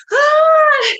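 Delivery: loud, very high mock baby cry; imitated baby wail. Baby starts crying as mom returns, loud mock wail. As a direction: belt out a baby's sudden wail. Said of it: "I started crying again".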